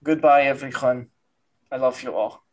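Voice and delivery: put-on low, tender Russian-accented farewell. Doctor saying goodbye to everyone, Russian accent. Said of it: "very Russian man".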